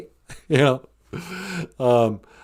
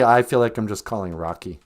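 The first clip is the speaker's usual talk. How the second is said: ordinary delivery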